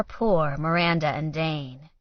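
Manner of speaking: ordinary delivery, nothing marked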